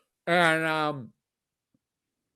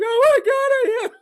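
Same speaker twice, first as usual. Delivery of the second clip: high, loud panicked cry of a shaken man; 5 dB louder than usual. Retold or imitated. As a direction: imitate a big man sobbing in panic. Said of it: "He's like".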